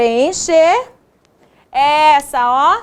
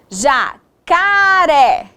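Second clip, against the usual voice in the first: "Jacaré" very slow, each syllable stretched apart; sounding out; 2 semitones higher, much slower than usual. Shown as a demonstration. Teacher sounding out word.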